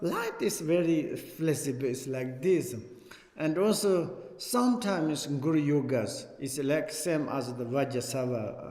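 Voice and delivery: ordinary delivery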